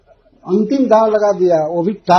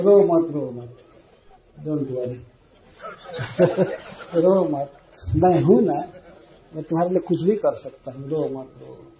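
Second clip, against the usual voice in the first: soft, lower coaxing, a bit hammed-up; husband begging his crying wife to stop; mock soothing husband; 4 semitones lower, 4 dB quieter than usual. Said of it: "पति कुछ भी करने के लिए तैयार है". (हल्का मज़ाकिया पुचकारना)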